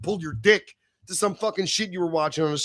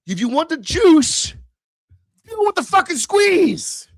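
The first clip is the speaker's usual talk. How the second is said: slow, higher and louder on each word; rising emphatic shout; 10 semitones higher, 6 dB louder, slower than usual. Real, not acted. To his viewers, repeating his motto, emphatic shout. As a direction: build it into a climax.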